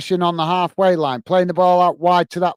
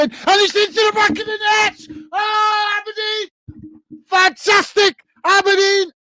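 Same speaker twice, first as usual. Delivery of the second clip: screaming goal celebration, very high; ecstatic goal shout; slower than usual. Real, not acted. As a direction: roar it out as the winner goes in. Goal scored in live commentary.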